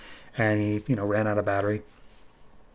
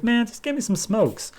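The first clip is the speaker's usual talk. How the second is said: put-on whiny kid; 11 semitones higher, 3 dB quieter than usual. Kid demanding cigarettes.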